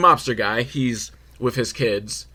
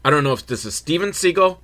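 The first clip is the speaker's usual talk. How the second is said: ordinary delivery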